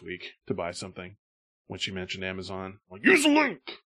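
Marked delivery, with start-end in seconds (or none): 3.0-3.8 high-pitched mock yell; retold nagging order. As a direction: squeal the order at your mother. Retold nagging of own mother.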